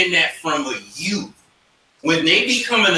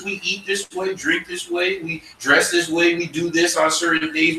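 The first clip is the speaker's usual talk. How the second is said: ordinary delivery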